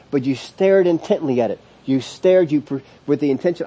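ordinary talk, nothing marked

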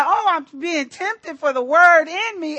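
loud, high mock rejoicing. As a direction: cheer it like the ideal believer. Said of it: "instead of rejoicing and going".

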